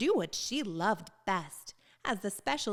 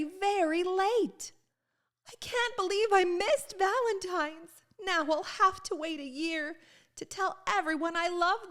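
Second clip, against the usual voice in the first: dismayed Ruby; 9 semitones higher than usual. Acted. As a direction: let it crack with dismay. Ruby dismayed at missing Valentine's.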